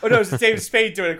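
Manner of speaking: ordinary talk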